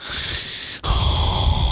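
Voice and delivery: put-on long, loaded drunk breaths; slow audible huffing exhale; heavy breathing. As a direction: imitate heavy breathing in the dark. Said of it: "starts heavily breathing in the dark".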